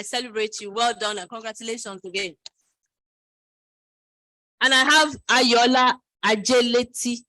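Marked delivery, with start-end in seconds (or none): 4.6-7.2 slow loud announcer voice; announcing. Real, not acted. Introducing the next speaker, slow loud announcement. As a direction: call the name out slowly and loudly.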